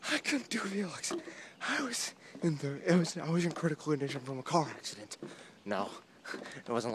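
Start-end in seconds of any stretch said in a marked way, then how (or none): 0.1-5.2 higher-pitched, hammed-up tale of woe; mock-dramatic excuse. Vlogger joking to viewers about missing vlogs, mock-dramatic. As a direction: pretend it was a terrible disaster.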